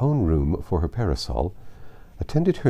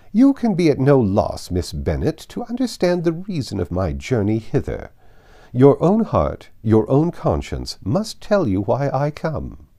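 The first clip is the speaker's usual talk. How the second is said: imperious accusation; 5 semitones higher, 5 dB louder than usual. Acted. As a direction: drive it home with cold authority. Lady Catherine confronts Elizabeth.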